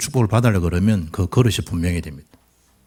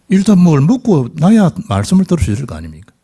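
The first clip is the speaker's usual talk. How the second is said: emphatic preaching; 6 semitones higher, 5 dB louder than usual. Real, not acted. Preacher pressing the point.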